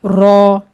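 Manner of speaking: रौ held full and heavy, o colour; thick ro. Shown as a demonstration. Teacher asks learners to read it thick, heavy रौ. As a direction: say रौ with a full mouth. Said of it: "मोटा पढ़ें".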